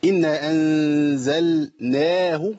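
slow chant, long aa in "أنزلناه"; recited madd. Shown as a demonstration. Teacher pointing out the small alif, "أنزلناه" chanted slowly. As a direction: draw out the vowels of "إنا أنزلناه" slowly. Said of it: "في ألف صغير كده بعد النون".

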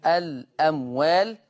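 الأموال with the hamza dropped, slow; الأموال read by Warsh with naql. Shown as a demonstration. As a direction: say الأموال as elemwel, carrying the vowel over. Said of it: "قرأها ورش بالنقل".

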